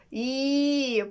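long, held "І" through a smile; smiling "ee". Acted. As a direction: stretch "ee" with a smile. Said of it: "посміхнувся наче". (усміхнене протяжне «І»)

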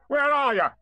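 high, loud searching call. A narrator's reading. Tigger searches for his friends, calling out. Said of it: "called Tigger".

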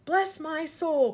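high, loud, drawn-out exclamation; astonished cry. A narrator's reading. Fire chief seeing a little boy, crying out. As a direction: stretch it out in amazement. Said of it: "cried the head of the fire department".

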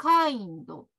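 "kind" as Japanese kaindo, with an added o; Japanese-accented "kind", ending in a clear vowel; katakana English. Shown as a demonstration. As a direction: add a full "do" to the end of "kind". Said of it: "カタカナ英語言ってみましょ".

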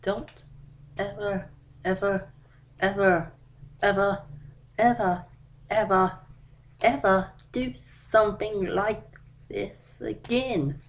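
each "ever" a separate, measured beat; stern repeated warning. Acted. Dad warns his daughter never to do it again, each ever stressed.

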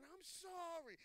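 high, wailing cry without full volume; high-pitched, drawn-out mock scream; retold screaming apology. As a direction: re-enact a desperate cried-out plea. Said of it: "the way I was screaming".

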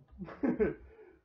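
big laugh. Real, not acted. Laughs at himself.

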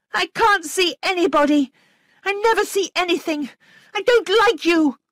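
put-on very high, loud child's voice; angry little girl. A narrator's reading. Flora turning on her governess, angry high-pitched denial. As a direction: throw a tantrum in a high child voice. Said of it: "Her face was suddenly ugly".

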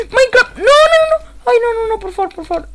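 high, wailing plea; panicked cry. Real, not acted. Player about to die in Minecraft, panicked wailing. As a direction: cry out in alarm, then beg.

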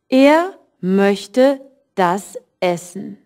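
slow, careful German read for learners; slow teacher demo reading; slow model sentence. Shown as a demonstration. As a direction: spell it out slowly for the class. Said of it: "you can say".

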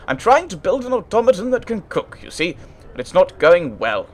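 high-pitched upper-class inventor; exasperated artificer. Acted. Artificer explains his failing cook automaton, exasperated.

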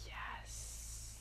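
barely audible, drawn-out whisper; hushed inward affirmation; whispered yes. Real, not acted. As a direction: breathe the word out in a whisper. Whispered yes inside the meditation.

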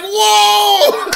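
high, loud laughing cheer; excited whoa. Retold or imitated. Narrator and friends reacting in the crowd, high laughing shout. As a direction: whoop with your buddies in the stands. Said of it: "we were like".

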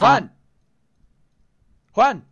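loud call across the room; calling a friend's name loudly. Real, not acted. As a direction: shout his name to get his attention. Calling out, friend can't hear.

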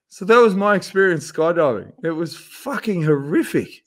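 usual speaking voice

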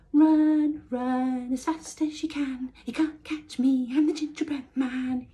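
high, loud teasing chant; shouting taunt. A narrator's reading. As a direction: shout the taunt over your shoulder. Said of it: "he shouted".

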